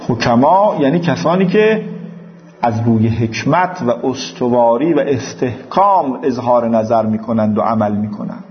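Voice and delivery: ordinary delivery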